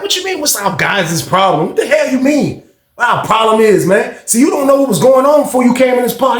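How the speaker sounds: retold affront, pitch climbing; indignant comeback. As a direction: imitate someone offended and challenging back. Offended man confronts the cellmate.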